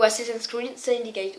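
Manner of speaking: Assassin's Creed Syndicate in a put-on English accent; show-off English accent on the game title. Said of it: "Oui, mon accent est super bien".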